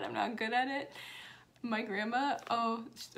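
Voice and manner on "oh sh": ordinary talk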